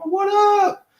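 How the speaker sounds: very high, put-on hello; mock street greeting. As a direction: pitch it way up as a chummy slang greeting.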